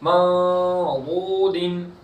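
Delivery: slow, drawn-out Quranic chanting; tajweed recitation. Shown as a demonstration. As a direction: intone each syllable slowly.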